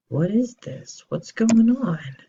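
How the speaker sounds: put-on high, startled older brother; concerned older brother. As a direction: ask it high and alarmed, like a brother who can't believe his eyes. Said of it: "He's very concerned".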